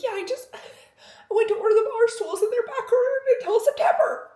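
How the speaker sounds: high, loud exasperated laughing complaint; laughing frustrated whine. Retold or imitated. Frustrated retelling to husband.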